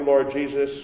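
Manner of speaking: ordinary talk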